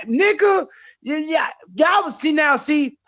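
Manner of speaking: high, loud retold shout; heated outburst. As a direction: relive the fury in the hospital. Retelling hospital rage.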